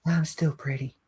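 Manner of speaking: small, high-pitched mutter in character; muttered character line. A narrator's reading. Green lizard as the passengers run past, high little mutter. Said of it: "you do hear her mutter".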